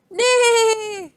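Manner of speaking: high, neighing horse cry; whinny it high like a horse. Acted. Horse answers Duck with a high whinny.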